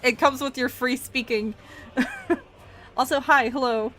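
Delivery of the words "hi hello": ordinary talk, nothing marked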